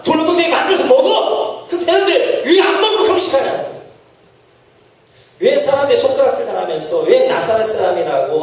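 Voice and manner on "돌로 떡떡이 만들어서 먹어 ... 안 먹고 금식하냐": higher, laughing taunt; mocking tempter. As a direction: sneer at the fasting one. Preacher voices Satan mocking.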